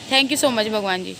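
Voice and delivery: laughing thanks. Real, not acted.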